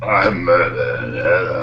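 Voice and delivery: very slow, low Batman voice. Shown as a demonstration. Friend does Bale's Batman after Adam West story.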